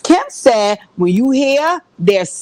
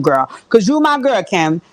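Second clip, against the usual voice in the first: ordinary delivery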